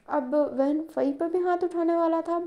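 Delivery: ordinary delivery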